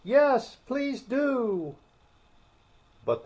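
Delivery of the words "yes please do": high, bright group plea; chorus of voices. Acted. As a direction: call out together, very high. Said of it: "the others all joined in chorus".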